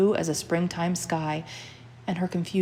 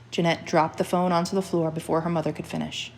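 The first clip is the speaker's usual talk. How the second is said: ordinary delivery, nothing marked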